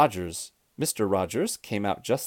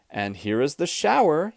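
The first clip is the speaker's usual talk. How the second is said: raised-pitch announcing call; understated storybook shout; mild shout; 6 semitones higher, 4 dB louder than usual. A narrator's reading. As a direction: call it out with some excitement. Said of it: "he shouted".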